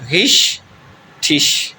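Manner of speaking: غشٹش very slowly in two separate parts; slow syllable-by-syllable demo of غشٹش. Shown as a demonstration. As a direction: split غشٹش after the first sh, pause, then finish. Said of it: "یہ شین کی آواز دے گا".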